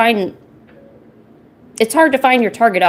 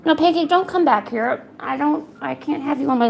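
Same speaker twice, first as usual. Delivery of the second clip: high mild scolding of the cat; pet talk; 7 semitones higher than usual. Real, not acted. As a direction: shoo the cat away gently.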